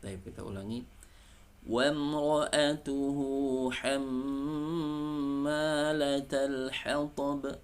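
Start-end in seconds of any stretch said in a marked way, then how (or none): 1.6-7.6 slow, high chanted recitation; Quran recitation. Shown as a demonstration. Teacher recites the verse for students. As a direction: chant it out slowly and higher.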